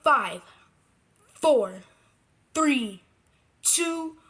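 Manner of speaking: normal talking voice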